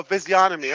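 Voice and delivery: "physiognomy" said fizzy-onomy, without g; silent G. Shown as a demonstration. Host trying Robin's version, G silent. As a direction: leave out the g in "physiognomy". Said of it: "G silent?".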